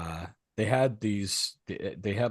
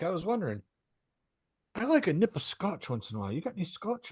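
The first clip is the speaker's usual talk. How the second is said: polite neighbor voice; 9 semitones higher than usual. Retold or imitated. Eugene asking for scotch, voiced.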